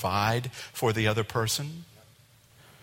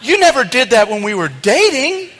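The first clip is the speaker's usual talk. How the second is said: high, loud, laughing mock disbelief; hammed-up shocked husband or wife; shocked spouse; 10 semitones higher, 8 dB louder, slower than usual. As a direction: play the stunned new spouse. Spouse shocked after the wedding.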